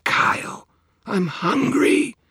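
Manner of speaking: very high, slow, whining hunger; hungry vampire whine. Acted. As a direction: whine like a starving creature.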